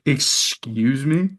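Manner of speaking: slow, stunned; slow shocked excuse me; stunned disbelief. Retold or imitated. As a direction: drag it out in shock. Hearing brother was stabbed.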